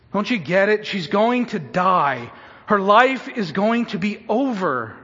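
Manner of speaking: loud, grief-stricken urgency; anguished plea. Acted. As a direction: drive the loss home, pausing between blows. Preacher voices the desperate father's grief.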